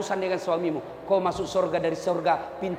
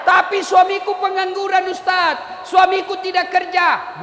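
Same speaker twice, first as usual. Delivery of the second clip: loud, higher complaint to the Ustaz; protesting wife; 8 semitones higher, 6 dB louder than usual. Acted. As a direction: plead your case loudly. (istri protes kepada ustaz dengan suara keras)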